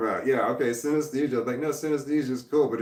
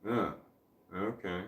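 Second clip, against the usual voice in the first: low, soft "oh... okay" with a gap; hesitate, then drop to a quiet okay; underwhelmed reaction; 3 semitones lower, 8 dB quieter than usual. Shown as a demonstration. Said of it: "as opposed to".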